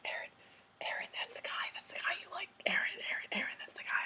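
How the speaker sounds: imitating friends' excited whispering; hushed, secretive nudging whisper; urgent whisper. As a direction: whisper it insistently, name over and over. Friends covertly alerting her near actor.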